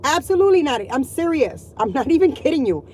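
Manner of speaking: normal talking voice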